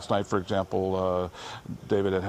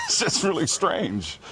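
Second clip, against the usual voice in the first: laughing; 7 semitones higher, slower than usual. Real, not acted. Laughing while telling diagnosis story.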